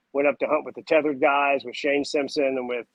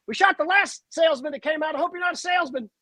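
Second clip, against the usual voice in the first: joking threat; 13 semitones higher than usual. Retold or imitated. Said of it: "He goes".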